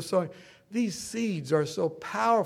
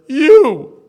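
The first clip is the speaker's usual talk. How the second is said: emphatic accusation; 7 semitones higher, 16 dB louder than usual. Retold or imitated. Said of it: "He said".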